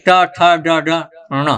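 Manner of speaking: "टा, ठा, डा, डा, अणोना" each from the palate, spaced out; retroflex series. Shown as a demonstration. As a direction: recite "टा, ठा, डा, डा, अणोना" with tongue curled back. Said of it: "मूर्धा से होता है, पीछे से".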